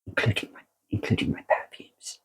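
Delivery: whispered, a playful confiding aside. Real, not acted. Vlogger confides to viewers that perfumes are a simple joy, whispered. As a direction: breathe it like a secret. Softly adds perfumes to life's joys.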